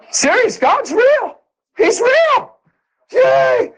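overjoyed cheering; ecstatic. Real, not acted. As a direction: shout it in pure joy. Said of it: "joyful testimony".

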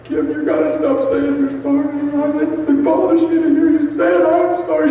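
high, loud tearful plea; imitating himself crying. Husband begging wife to stop saying sorry, imitated high tearful plea. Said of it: "I started crying so hard".

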